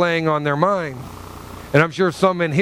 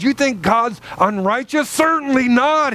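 higher-pitched incredulous question, then emphatic denial; emotional, indignant rebuttal; 5 semitones higher than usual. Acted. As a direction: read Paul with feeling, pitched up. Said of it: "grab some of the emotion and the feeling".

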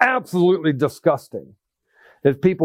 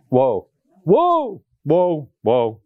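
preached woe; 3 semitones lower, much slower than usual. Shown as a demonstration. Sermon all on woe.

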